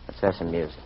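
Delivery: low, hushed invitation; soft suggestion. Acted. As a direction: murmur it gently. Quiet coaxing, then repeated louder.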